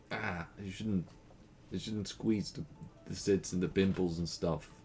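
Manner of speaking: usual speaking voice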